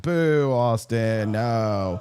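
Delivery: long drawn-out mock boo; hammed-up heckling; imitated booing. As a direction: imitate a jeering crowd, stretching each word. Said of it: "booing me".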